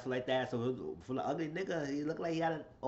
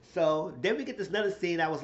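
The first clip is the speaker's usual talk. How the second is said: ordinary talk, nothing marked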